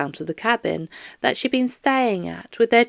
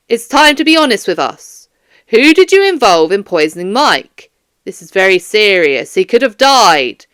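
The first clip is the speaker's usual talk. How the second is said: firm, louder police interrogator; stern detective; 11 dB louder than usual. Acted. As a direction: press her hard for a confession, like a detective. Stern detective questions Doris.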